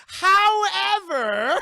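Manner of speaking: huge, high, drawn-out shout; hammed-up cliffhanger announcement; dramatic twist. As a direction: belt it slowly, rising at the end. Dramatic twist read from page.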